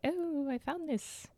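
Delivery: a lightly put-on British accent, slow and soft. Podcaster mocking a detectorist's find, lightly put-on British accent. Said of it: "this is my, my British accent".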